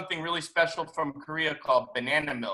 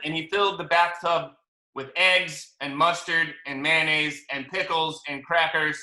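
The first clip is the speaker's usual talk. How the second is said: ordinary delivery, nothing marked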